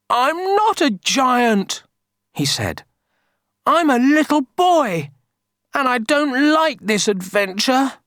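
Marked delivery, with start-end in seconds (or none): 0.1-1.8 high, slow, mock-tearful boy. A narrator's reading. Kipper crying before the villagers, tearful. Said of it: "Kipper began to cry". 3.7-8.0 put-on high-pitched little boy's wail; a storybook whine close to tears; tearful. A narrator's reading. Kipper crying about his adventure, tearful. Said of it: "Kipper began to cry".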